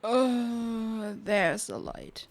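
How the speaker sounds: ordinary talk, nothing marked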